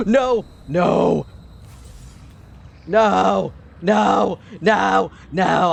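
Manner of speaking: loud high repeated no; screaming no. Real, not acted. Gamer screaming at the screen while nearly dying, frantic. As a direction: yell no over and over in real panic. About to die in game.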